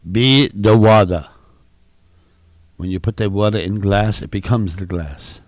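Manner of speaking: a hint of Bruce Lee's Chinese-American accent, preaching; mock Bruce Lee. Quoting the Bruce Lee commercial in a mild Bruce Lee accent. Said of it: "What fucking accent is that?".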